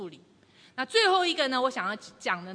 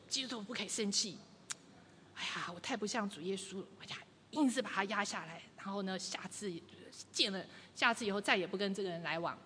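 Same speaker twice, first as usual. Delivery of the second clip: through gritted teeth; 3 semitones lower, 8 dB quieter than usual. Retold or imitated. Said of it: "还是你咬牙切齿地说".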